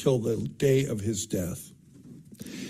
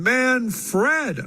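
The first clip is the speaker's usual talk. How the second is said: a high, insistent bawl on the name; 10 semitones higher, 3 dB louder than usual. A narrator's reading. Miss Bass Blue correcting the room, bawling high. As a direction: blurt the correction out loud, high and sure. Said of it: "Here bawled out Miss Bass Blue".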